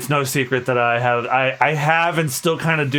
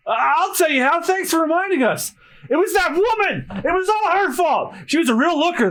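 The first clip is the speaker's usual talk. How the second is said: high whiny pirate; 12 semitones higher than usual. Acted.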